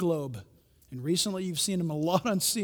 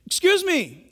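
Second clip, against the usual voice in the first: high, loud call to passers-by; 11 semitones higher, 8 dB louder, slower than usual. Retold or imitated. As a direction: hail the crowd once more. Calling out again to crowd.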